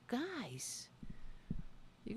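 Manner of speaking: soft unvoiced whisper. Real, not acted. Streamer touched by donations, whispers to the chat. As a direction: breathe it, touched.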